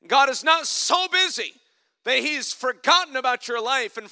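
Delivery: loud, high, driving pulpit delivery; preaching at full force. Acted. Preacher to his congregation about God not forgetting, loud preaching. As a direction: build it up and hammer it home to the congregation.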